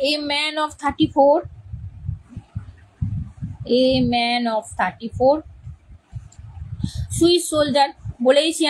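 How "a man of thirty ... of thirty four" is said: very slow, word by word for writing down; dictating to students at a crawl; slow dictation. Shown as a demonstration. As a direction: drag each word out so they can copy it. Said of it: "তাহলে এটা লেখো".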